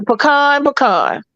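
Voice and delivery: "pecan" as puh-KAHN; southern puh-KAHN for "pecan"; southern drawl. Shown as a demonstration. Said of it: "It's the Southern drawl".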